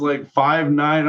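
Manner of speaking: usual speaking voice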